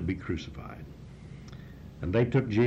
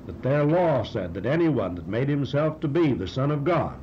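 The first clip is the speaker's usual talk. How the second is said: normal talking voice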